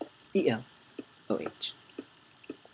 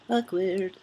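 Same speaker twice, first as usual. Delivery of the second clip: AWKWARD drawn out in a higher pitch; playful singsong; 6 semitones higher, 4 dB quieter than usual. Real, not acted. Player fills an awkward moment alone, playful singsong. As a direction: sing out "Awkward" after a little laugh. Repeats it playfully after a laugh.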